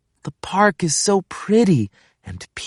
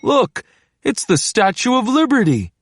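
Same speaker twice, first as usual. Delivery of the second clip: higher, lighter voice for Dad pointing out; dad's character voice; 9 semitones higher than usual. Acted. Dad spotting the statue from the tower, in a higher voice. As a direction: lift the voice for an eager dad.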